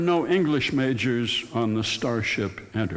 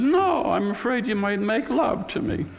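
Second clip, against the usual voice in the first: Lena voice; 10 semitones higher than usual. Retold or imitated. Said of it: "And she said".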